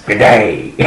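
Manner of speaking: a hint of a rough Australian accent; a lightly done Australian accent, on request. Shown as a demonstration. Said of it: "Ah, come on, do it".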